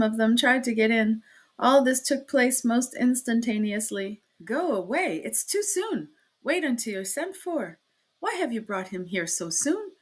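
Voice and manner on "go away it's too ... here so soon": ordinary delivery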